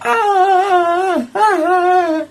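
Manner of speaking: the shop worker screeching in alarm, mocked; imitated scream. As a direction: mimic her high panicked shriek. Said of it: "started screaming like".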